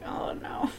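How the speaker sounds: soft breathless dread; hushed unvoiced dread; whispered oh no. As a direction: whisper it in dismay. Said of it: "I'm like".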